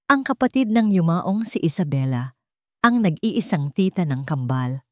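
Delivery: plain reading voice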